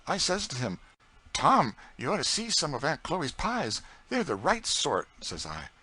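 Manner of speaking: put-on a cheeky boy egging the cook on; young George. A narrator's reading. George teasing Aunt Chloe about other cooks, boyish voice.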